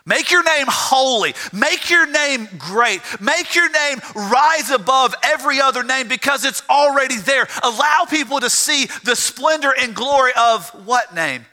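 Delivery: high, swelling pulpit proclamation; impassioned preaching. Real, not acted. Preacher calling for God's name exalted, impassioned preaching. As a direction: lift the pitch and proclaim each plea.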